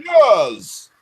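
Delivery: high, shouted British "Yes!" with a big flourish; mock British yes. Host mocks the British YouTuber's "Yes!" with a high shout. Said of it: "He can't say years without throwing, doing this".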